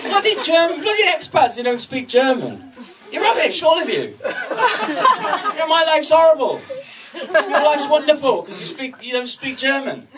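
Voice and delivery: high-pitched, hammed-up exasperation; comic angry rant. Comic rant at monolingual expats.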